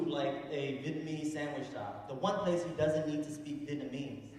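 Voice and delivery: normal talking voice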